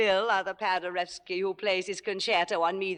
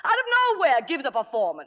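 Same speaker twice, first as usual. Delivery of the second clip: indignant outburst; 4 semitones higher, faster than usual. Acted. Star raging at understudy's surprise performance, indignant outburst. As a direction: punch it out in high outrage.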